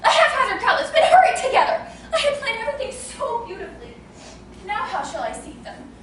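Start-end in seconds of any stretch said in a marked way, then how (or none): 0.0-3.9 going mad. Acted. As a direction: wail about the ruined dinner party. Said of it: "I shall go mad!".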